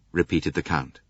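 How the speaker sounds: ordinary delivery, nothing marked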